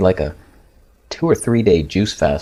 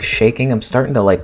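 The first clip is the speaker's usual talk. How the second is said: normal talking voice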